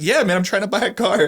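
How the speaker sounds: high, put-on voice of an eager buyer; eager customer. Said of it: "The other guy's like".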